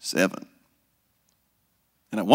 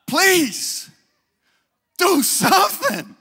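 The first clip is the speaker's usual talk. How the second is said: high-pitched pleading mama voice; mock mother begging, rising to a high plea; 11 semitones higher, 3 dB louder than usual. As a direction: nag him, then beg him, higher and higher.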